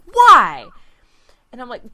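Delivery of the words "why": loud, high indignant why; mock indignant why. Coworker protesting to helper, loud high why. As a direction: fire back a loud baffled why. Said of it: "And she's like".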